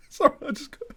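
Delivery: high laughing sorry; giggling apology. Real, not acted. Gamer laughing at a Muppet-like noise, high giggle. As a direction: crack up mid-word.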